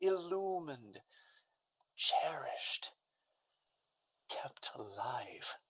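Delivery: fading to a broken whisper; grieving whisper. Acted. Banished Valentine mourning Sylvia, fading whisper. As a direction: let the words die on the breath. Valentine's grief fades away.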